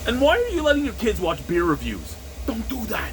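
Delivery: high-pitched, incredulous telling-off; indignant high protest; exasperated scolding. Acted. Mock-scolds parents of young viewers.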